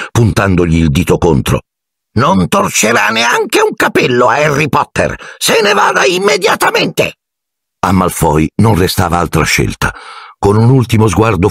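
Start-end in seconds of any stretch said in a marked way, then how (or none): 2.2-7.1 high elf threat climbing to a shriek; Dobby, fierce. A narrator's reading. Elf threatens Malfoy to protect Harry, fierce. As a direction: warn fiercely, then screech the order. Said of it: "disse in tono feroce".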